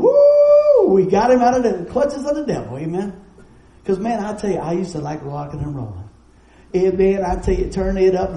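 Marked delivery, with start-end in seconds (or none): 0.0-2.8 whooping high and loud, then still loud with joy; jubilant cheer. Retold or imitated. Angels in heaven cheering a saved sinner, jubilant whoop. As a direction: throw a huge celebratory whoop.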